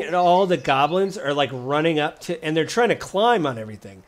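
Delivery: ordinary talk, nothing marked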